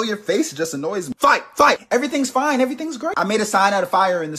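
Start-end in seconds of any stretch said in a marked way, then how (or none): 1.2-3.1 excited. Acted. Egging on a fight.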